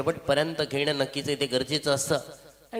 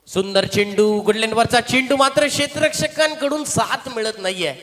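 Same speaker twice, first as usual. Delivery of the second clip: raised, louder play-by-play burst; excited commentary; 6 semitones higher, 6 dB louder than usual. Real, not acted. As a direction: call the ball with rising excitement. Live commentary on a good ball. (उत्साही समालोचन)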